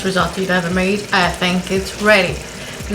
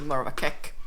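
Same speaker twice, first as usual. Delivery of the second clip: usual speaking voice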